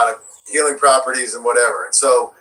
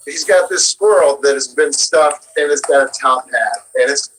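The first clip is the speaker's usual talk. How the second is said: normal talking voice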